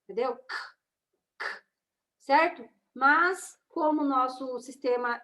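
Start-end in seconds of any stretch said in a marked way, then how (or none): 0.4-1.6 K as a bare voiceless click, no vowel; isolated phonic K; letter sound. Shown as a demonstration. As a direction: release only the hard K sound, very short. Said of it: "o som da letra".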